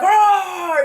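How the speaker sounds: a loud, high mock roar, belted out; drunken roar. Drunk husband storming in on the fight, roaring loud. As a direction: roar like a wild beast, hammed up. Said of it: "he's like".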